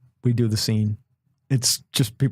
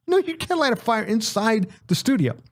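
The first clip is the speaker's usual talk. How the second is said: mock security guard; 9 semitones higher than usual. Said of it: "The guy's like".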